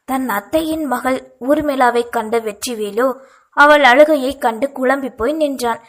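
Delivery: ordinary delivery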